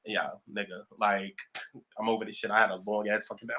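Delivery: deep, fed-up grumble; low tired voice. Retold or imitated. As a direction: drop way down, worn out. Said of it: "I'd be like".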